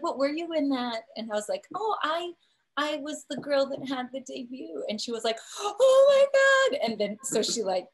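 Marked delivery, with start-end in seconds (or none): 0.0-1.0 higher-pitched curious ask; mildly astonished, raised-pitch query; retold surprised question. 1.7-4.8 high, light reply; hesitant high-pitched confession; retold modest reveal. Retold coy answer to stylist. 5.6-6.9 very high, loud, drawn-out amazement; slow, high-pitched astonished cry; retold shocked gasp. Retold stylist's shocked recognition.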